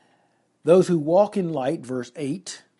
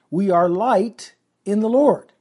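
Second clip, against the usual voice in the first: usual speaking voice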